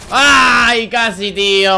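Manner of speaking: loud, high near-miss cry; excited shout. Real, not acted. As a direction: yell it, almost had him. Near kill in game. (grito emocionado)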